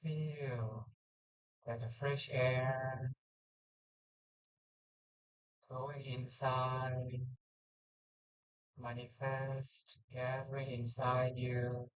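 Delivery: slow, calm meditation voice; guided meditation. Acted. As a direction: guide the breath gently and slowly.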